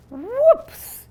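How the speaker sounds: high, loud startled whoops. Acted. Boy slips off the vine, high loud yelp. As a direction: yelp as you slip. Boy loses his grip.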